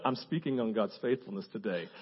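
low, soft realization ending in a breathy yes; sudden realization. Retold or imitated. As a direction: hush it as it dawns on you. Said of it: "all of a sudden I went".

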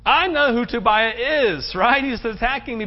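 exasperated leader brushing them off; a touch of Nehemiah's exasperation. Retold or imitated. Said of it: "Nehemiah's going like".

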